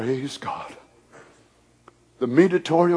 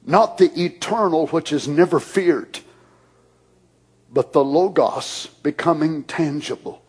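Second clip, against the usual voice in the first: ordinary delivery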